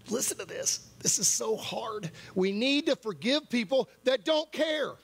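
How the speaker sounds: high, urgent preaching; impassioned plea. Real, not acted. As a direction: plead with the congregation, pitch lifted. Preacher presses a hard truth.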